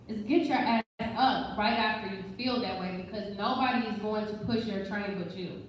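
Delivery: ordinary talk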